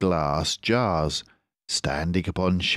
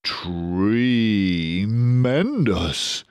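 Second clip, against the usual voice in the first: slow, drawn-out exclaim, a bit louder; mild shout; 4 semitones higher, 3 dB louder, much slower than usual. Acted. Badger exclaims at the cider, a mild shout. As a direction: savour it loudly. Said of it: "shouted Badger".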